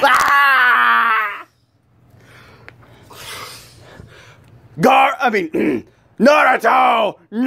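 drawn-out anguished cry; anguished shout. Acted. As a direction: cry out slowly in despair. Acted cry for a fallen ally.

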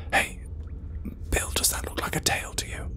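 ordinary delivery, nothing marked

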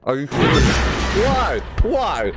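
loud, high furious yelling; angry outburst. Real, not acted. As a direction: yell at the doll in anger. Annoyed at the doll.

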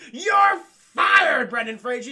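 loud, high, drawn-out firing yell; furious mock shout. Kate firing Brendan Fraser, furious retold shout. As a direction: belt it out like an outraged boss.

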